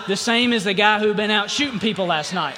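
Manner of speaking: shouted sermon climax; preaching shout. Real, not acted. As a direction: drive the point home with full voice. Preaching at full force.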